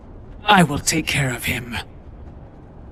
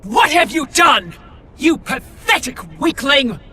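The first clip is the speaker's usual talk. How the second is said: high, raised furious question; angry demand; 8 semitones higher than usual. Acted. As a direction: confront him in rage. Furious confrontation with Spartan.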